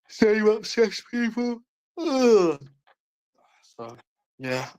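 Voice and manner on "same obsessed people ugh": slow, high, sneering mock voice; exaggerated disdain, stretched out slowly. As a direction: pull a face and drawl it out high. Mocking the obsessed viewers.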